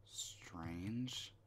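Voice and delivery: soft unvoiced whisper. Real, not acted. Player whispering to himself at a strange door, hushed. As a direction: breathe the word out, puzzled. Puzzled aside while exploring.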